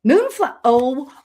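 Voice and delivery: real excitement. Real, not acted. Reader sees Betty's card and gets so excited, thrilled. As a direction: gasp it out, thrilled by what turned up. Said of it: "I get so excited".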